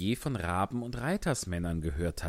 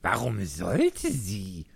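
grumbling; much slower than usual. A narrator's reading. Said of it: "brummte der Hase".

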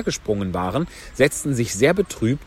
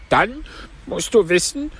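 high, plaintive turtle voice; Mock Turtle; 9 semitones higher than usual. A narrator's reading. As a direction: pitch it up for the tearful turtle. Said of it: "fiel die falsche Schildkröte ein". (hohe Stimme der falschen Schildkröte)

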